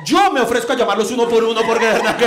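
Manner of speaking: loud, emphatic mock offer; emphatic volunteering. Elvira offers to call them one by one, loud and emphatic. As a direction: offer it loudly, hamming it up.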